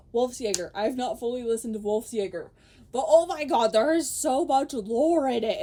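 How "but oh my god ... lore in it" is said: loud, gushing enthusiasm; loud excited gushing over the lore. Real, not acted. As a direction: burst out about the lore.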